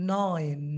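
Nine drawn out low and long; stretched nine. Shown as a demonstration. Teacher demonstrating a long nine. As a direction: hold the vowel of Nine. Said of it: "এই নাইন টেনে বলতে হবে".

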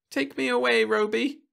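put-on high, pleading child voice; begging little girl. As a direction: beg like a frightened girl. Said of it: "The little girl begged".

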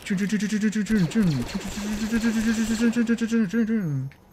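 high, rapid mouth-made blaster sounds; spray the room with imitated laser shots; excited blaster noises. Real, not acted. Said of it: "Perdón, me emocioné".

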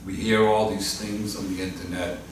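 soft, hushed prayer voice; closing prayer. Real, not acted. Pastor closing the service in prayer, hushed and reverent. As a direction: pray quietly before the congregation.

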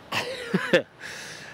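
high squealing burst of laughter; shrieking laugh. Real, not acted. Ranting vlogger squeals with laughter at his own fury. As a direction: let a squeaky giggle escape.

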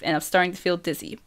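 ordinary delivery, nothing marked

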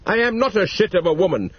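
snapping back. A narrator's reading. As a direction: snap it back, offended. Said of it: "she snapped back".

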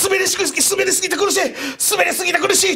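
ずっと高い声で苦しさを訴える真似; 高く裏返った声で「苦しい」と繰り返す; 苦しむ叫び. Retold or imitated. 相方の苦しむ叫びを再現. (滑って苦しむ相方を真似る、高い悲鳴まじりの声)

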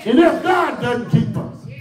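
rising preacher's shout, half-sung; preaching at full force. Acted. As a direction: lift the church with every line. Whooping climax over music.

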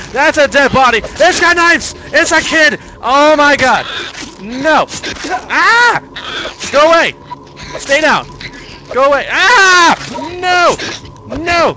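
high, loud panicked shrieks; terrified screaming. Real, not acted. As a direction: scream in pure terror. Sees a kid with knives.

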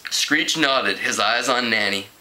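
ordinary delivery

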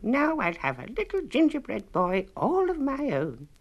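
put-on a little old lady delighted with her baking; lightly voiced pleased old woman. A narrator's reading.

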